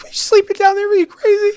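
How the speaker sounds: high, loud disbelief at a crazy idea; incredulous. Retold or imitated. To the coworker who naps in the haunted basement, high incredulous. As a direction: shoot it up high like she's lost her mind. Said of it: "I'm like".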